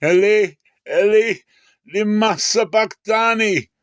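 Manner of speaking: a desperate loud cry to God. A narrator's reading. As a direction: call out each word from the cross. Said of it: "Jesus cried with a loud voice".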